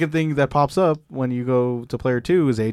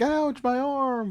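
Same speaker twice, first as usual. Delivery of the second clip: high, very slow hammed-up pain; mock-wounded wail, much higher; overselling wrestler; much slower than usual. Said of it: "oversell his arm".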